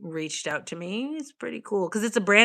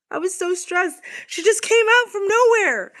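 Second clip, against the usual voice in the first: high, loud startled rush; startled excitement; 13 semitones higher, 7 dB louder than usual. Real, not acted. Surprised by the cat appearing, high and loud. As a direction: blurt it out, still shaken.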